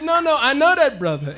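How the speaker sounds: loud, high re-enacted insistence; excited insistence. Traveler insisting to the doubting vendor, excited.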